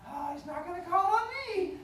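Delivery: high, loud mock-kid voice; imitating relieved pupil. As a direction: sing out like a child who feels safe. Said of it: "they're like".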